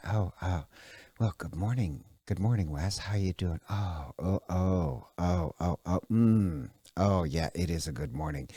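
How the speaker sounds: low, soft, hushed radio host voice; NPR-Interviewerin. Acted. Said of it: "she speaks like this".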